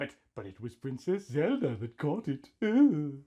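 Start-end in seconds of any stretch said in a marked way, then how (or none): none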